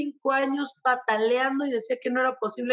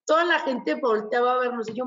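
ordinary talk

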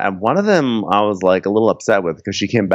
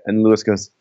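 usual speaking voice

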